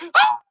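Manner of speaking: very high mock scream; startled shriek. Radio guy as the door gets kicked in, mock startled shriek. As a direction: yelp like a startled radio host.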